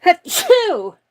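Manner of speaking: loud real sneeze; big explosive sneeze; sneezing. Real, not acted. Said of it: "I have to sneeze".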